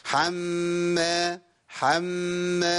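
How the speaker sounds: slow "حم", nasal m held before release; slightly lengthened ghunna. Shown as a demonstration. Teacher drilling the doubled meem, long hummed "حم". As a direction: sustain the hummed م in "حم" on a level tone. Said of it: "هزود زمن شوية".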